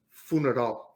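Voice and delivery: put-on English word said as if it were Spanish, Spanish accent; short answer in a put-on Spanish accent; mock Spanish accent. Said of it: "what's the Spanish word for funeral".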